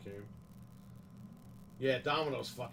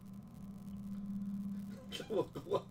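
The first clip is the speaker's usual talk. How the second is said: wheezy laugh; 6 semitones higher, 7 dB quieter than usual. Real, not acted. Laughs at card.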